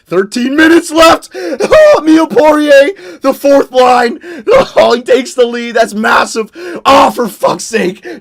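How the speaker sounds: loud, high shouts over the late goal; way too excited. Real, not acted. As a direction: yell the goal like a thrilled fan announcer. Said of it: "I got way too excited".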